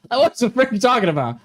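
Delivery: high, incredulous comeback; mock exasperated retort. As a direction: throw it back in acted disbelief. Acted retort to ex.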